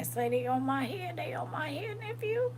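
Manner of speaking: high-pitched excited squeal; exclaiming high. Real, not acted. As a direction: squeal it out, voice rising.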